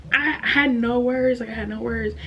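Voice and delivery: high, tearful voice; choked up. Real, not acted. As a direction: push the words out through real tears. Retelling failed exam, still tearful.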